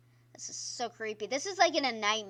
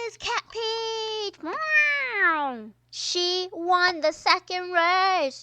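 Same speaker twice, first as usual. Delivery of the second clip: slow, much higher, booming announcer; 8 semitones higher, 10 dB louder, much slower than usual. Pretend. Announcing the winner to viewers, slow booming announcer voice. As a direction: call the winner like a race host.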